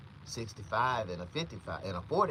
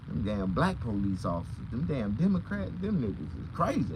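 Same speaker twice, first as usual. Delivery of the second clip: normal talking voice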